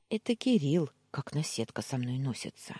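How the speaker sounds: ordinary delivery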